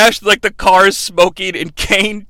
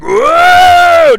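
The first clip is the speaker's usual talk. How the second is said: loud, high, drawn-out "whoa"; mock cry of a crashing driver; long yelled whoa; 7 dB louder than usual. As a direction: holler a long startled whoa. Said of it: "Kane's like".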